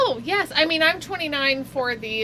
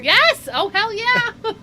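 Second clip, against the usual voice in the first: higher, louder, slow and laughing; excited cheer; 11 semitones higher, 9 dB louder, much slower than usual. Real, not acted. Eager reply about the Jetsons.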